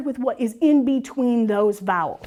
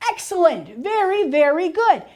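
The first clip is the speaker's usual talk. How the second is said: higher, encouraging teacher praise; enthusiastic praise; 6 semitones higher than usual. Acted. Teacher praising a child who worked out the spelling, enthusiastic praise. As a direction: praise a pupil with a lift in pitch.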